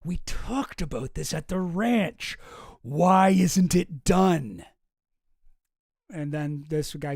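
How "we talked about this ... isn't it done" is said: slow, drawn-out exasperated demand; mock-indignant boss, dragging the words; not having it. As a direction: stretch every word out, fed up and demanding. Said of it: "Epstein is not having it".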